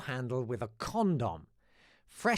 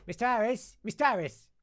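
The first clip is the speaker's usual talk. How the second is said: high, loud schoolgirl calls; calling out; 10 semitones higher, 7 dB louder than usual. Retold or imitated. Pupils calling their teacher, loud high calls. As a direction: shout the teacher's name across the room.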